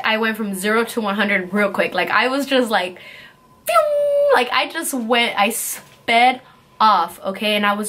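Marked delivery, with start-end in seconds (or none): 3.7-4.3 high, sustained "pew" whoosh; speeding-off noise. Retold or imitated. Storyteller showing how fast she took off, high zooming noise. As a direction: imitate a car zooming away.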